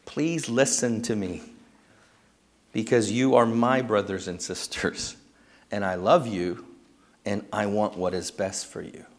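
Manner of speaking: quiet heartfelt plea to the congregation; tender personal appeal. Real, not acted. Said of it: "Church family".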